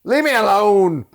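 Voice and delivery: high, loud whining cry of leave me alone; mock yell. Quoting a movie character, high loud whine. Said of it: "He's like".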